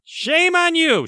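high, drawn-out mock shaming; mock scolding. Host to listeners who missed last week's episode, mock scolding.

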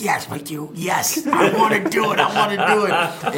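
rising giddy laughing yes; bursting with joy, laughing it out; barely contained glee. Retold or imitated. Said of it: "I try to hold it in".

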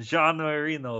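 "Jean" as zhahn, then "Marino"; French pronunciation. Shown as a demonstration. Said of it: "That's what I call him".

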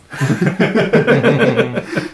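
loud bright burst of laughter; hearty laugh. Real, not acted. Laughs after forgetting the word.

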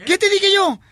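high mock-indignant mum; indignant mother. Joke mother challenges her son's excuse, indignant. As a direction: fire back as an affronted mother. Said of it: "llega la mamá".

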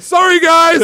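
high, loud sing-song sorry; breezy brush-off while walking in; mocking apology. Friend to the players, high mock apology. Golic waving them off.

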